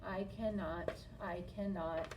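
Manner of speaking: soft, fading chug rhythm; chugging away. A narrator's reading. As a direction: chug off into the distance.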